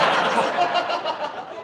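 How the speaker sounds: airy laughter after the joke; soft unvoiced chuckle; breathy laugh. Real, not acted. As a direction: laugh at your own punchline.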